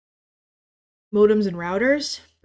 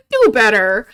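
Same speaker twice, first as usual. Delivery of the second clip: loud scold; 3 semitones higher, 12 dB louder than usual. Real, not acted. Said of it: "scolding viewers".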